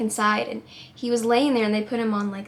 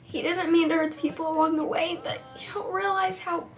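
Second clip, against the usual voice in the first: real crying; 4 semitones higher, 3 dB quieter than usual. Real, not acted. Telling viewers his apology, real crying. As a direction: voice pitched higher and strained through tears. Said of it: "I'm a mess".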